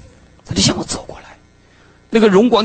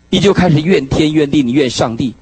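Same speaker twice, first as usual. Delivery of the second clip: usual speaking voice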